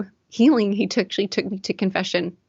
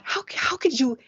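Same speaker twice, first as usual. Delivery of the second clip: desperate plea; 6 semitones higher than usual. Retold or imitated. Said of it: "I'm so desperate".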